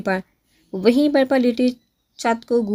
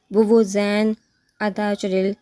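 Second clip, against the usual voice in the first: ordinary delivery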